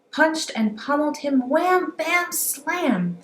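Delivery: action-packed storyteller punch; lively fight words. A narrator's reading. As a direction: bang out each hit word. Fight sounds narrated.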